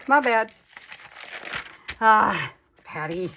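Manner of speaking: ordinary talk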